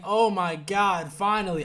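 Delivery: slow, loud and high "oh my God, finally"; relieved excitement. Retold or imitated. Fan finally sees the couple get together, loud relieved delight. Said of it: "I was like".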